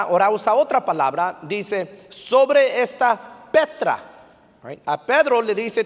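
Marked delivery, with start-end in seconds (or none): 2.1-4.0 SOBRE high and loud, pause before Petra; slow, raised "sobre esta... Petra"; emphatic contrast. Retold or imitated. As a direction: set "Petra" apart, slow and falling. Contrasting the second Greek word.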